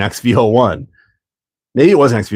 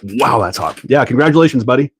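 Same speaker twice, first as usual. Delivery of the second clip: ordinary talk, nothing marked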